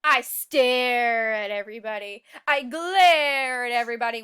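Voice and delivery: menacing bad seed. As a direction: own the stare and glare.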